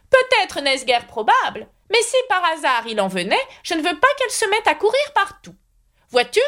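put-on high, fussy old knight voice; high knightly voice promising to escort Alice. A narrator's reading. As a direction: play the kindly, dotty knight up high. Knight rambles.

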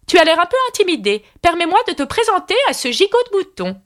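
high-pitched queen making introductions; haughty queen. A narrator's reading. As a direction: introduce them with royal ceremony. Red Queen makes formal introduction. (reine hautaine, voix haut perchée)

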